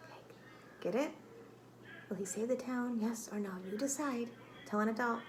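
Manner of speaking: normal talking voice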